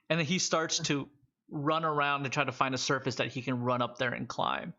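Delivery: ordinary talk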